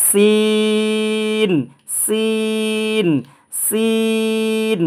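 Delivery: long, intoned "সিন" recitation; three-beat elongation. Shown as a demonstration. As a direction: stretch "সিন" as seeeen with a hissed s. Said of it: "তিন আলিফ টেনে পড়বেন".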